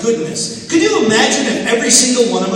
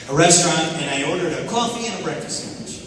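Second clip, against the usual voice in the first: ordinary delivery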